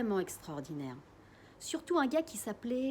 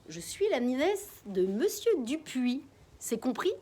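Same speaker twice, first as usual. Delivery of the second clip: slow, emphatic orders; firm instructions; 4 semitones higher, 4 dB louder, slower than usual. Acted. Disguised mother drilling the family on their cover story. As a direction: lay out the cover story firmly.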